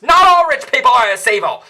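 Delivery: loud indignant correction; exasperated shout. Acted. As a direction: yell it in disbelief. Ranting at the film's villains.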